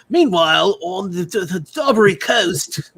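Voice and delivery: loud, hammed-up Australian TV narrator; bad Australian accent. Mocking a border security TV show, bad Australian accent. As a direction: do a comically bad Australian documentary voice-over. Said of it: "Today on Accents Done Poorly".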